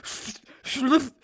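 stumbling then yelling Schlüssel; mock blunder shout. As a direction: trip over the word, then blurt it out loud. (gespielter Versprecher, laut herausgeplatzt)

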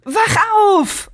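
high transformation cry; bright magical girl. Retold or imitated. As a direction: shout it like a heroine. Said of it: "Was habt ihr immer verstanden?".

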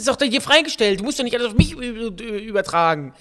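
high, loud, worked-up objection; aggressive protest. Real, not acted. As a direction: push the blame back, loudly. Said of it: "Bennys Aggressionsproblem". (aggressiver, aufgebrachter Protest)

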